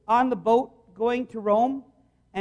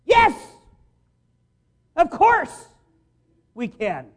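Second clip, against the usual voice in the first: much higher and louder, bursting with eager agreement; eager yes; 5 semitones higher, 8 dB louder, slower than usual. Acted. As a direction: shout it out like excited young disciples. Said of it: "can't you just hear that in their voice?".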